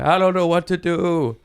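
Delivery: high, loud panicky voice; helpless pilot. Retold or imitated. Airplane driver to passengers, panicky high whine. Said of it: "the airplane driver's like".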